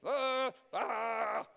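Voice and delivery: mock agonized "uh, ahh"; indecisive groan. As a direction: groan as if torn over a decision.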